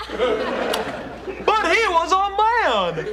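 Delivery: high, slow, laughing disbelief; surprised. Acted. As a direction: gasp it out in shock.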